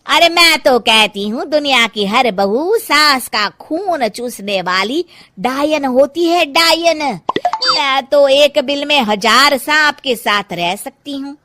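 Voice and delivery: loud, scornful gossiping neighbour; loud mocking scorn. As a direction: jeer loudly at every daughter-in-law. Mamta mocks Sushila, scorns daughters-in-law. (ताना मारती हुई ज़ोरदार आवाज़)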